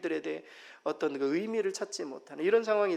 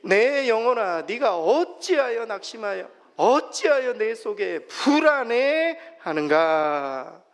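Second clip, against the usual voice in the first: loud, slow, solemn psalm reading; scripture reading aloud; 4 semitones higher, 8 dB louder, slower than usual. Acted. Pastor leading the congregation in Psalm 42, loud slow reading. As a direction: read it out together, drawn out. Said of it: "같이 읽습니다".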